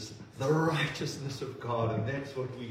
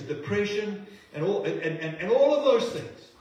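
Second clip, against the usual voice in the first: triumphant preaching; 5 semitones higher than usual. Acted. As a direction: lift the room, rising to a rousing climax.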